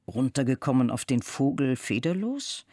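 ordinary delivery, nothing marked

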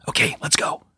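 hushed, breathy whisper. A narrator's reading. Trent whispering to Judith while hiding in the bushes, whispered. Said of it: "Trent whispered".

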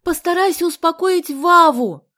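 high, slow, forceful shout. A narrator's reading. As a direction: yell an urgent order over noise. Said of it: "закричала ему Галя на ухо".

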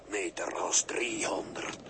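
breathy, urgent countdown whisper. Acted. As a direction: hiss the distance under your breath. Ghost counts down to Ros. (fluisterend aftellen)

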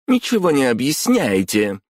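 high, heated accusation. A narrator's reading. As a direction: throw complaints at the boss, voice lifted. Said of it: "повысила голос Майоко".

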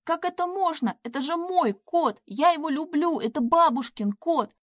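high, distraught pleading; tearful outcry. A narrator's reading. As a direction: protest as if through tears. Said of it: "закричала в слезах Надя".

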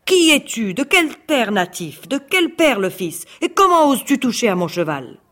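high, loud enraged tsar; furious shout. Acted. Tsar Kousman confronting the horse thief, furious shout. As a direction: roar the questions at the intruder. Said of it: "Le tsar cria très en colère".

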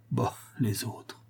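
slow whispered scoff; raving father whispering a scornful aside; dismissive whisper. A narrator's reading. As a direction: breathe it out with contempt.

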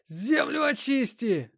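very high, slow, strained cry; terrible-voiced shout. A narrator's reading. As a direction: cry out in a dreadful voice. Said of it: "страшным голосом закричал я". (крик страшным голосом)

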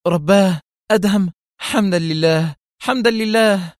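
high-pitched, rising exclamations of relief; eager relief. A narrator's reading. Mona sees Adham alive, eagerly relieved. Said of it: "قبل أن تهتف في لهفة".

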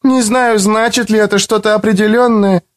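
put-on unsure young student; young woman voice. A narrator's reading. Ivetta character voice.

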